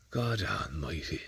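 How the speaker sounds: hushed. Real, not acted. Hushed sight of the cow.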